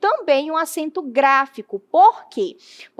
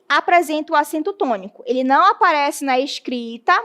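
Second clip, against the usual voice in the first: usual speaking voice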